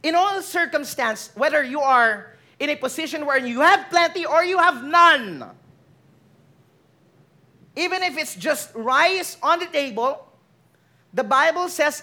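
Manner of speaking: ordinary talk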